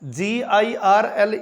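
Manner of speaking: normal talking voice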